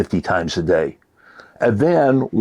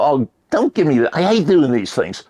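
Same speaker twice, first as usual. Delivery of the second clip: higher, exasperated mock complaint; frustrated; 5 semitones higher than usual. Student complaining about brain teasers, mildly frustrated. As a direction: whine mildly about hating the task.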